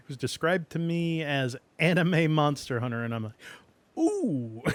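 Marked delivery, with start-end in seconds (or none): 4.0-4.6 high excited ooh; a delighted rising ooh; intrigued ooh. Retold or imitated. Reacting to anime Monster Hunter.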